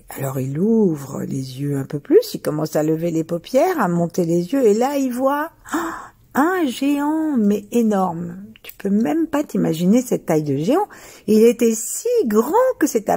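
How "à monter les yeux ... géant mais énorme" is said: plain reading voice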